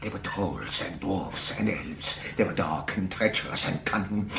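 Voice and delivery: very low, soft and brooding; low hushed dread; ominous hush. Acted. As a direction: sink into a dark, haunted murmur. Lecturer grows haunted by his subject.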